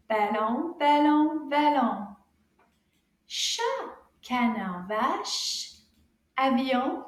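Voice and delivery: rhythmic word-by-word rhyme for repeating; nice and loud recitation. Shown as a demonstration. Teacher leads children through French rhyme, loud rhythmic recitation. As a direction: chant each word clearly, with pauses. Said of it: "repeat nice and loud".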